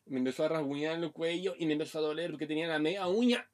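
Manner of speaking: ordinary talk, nothing marked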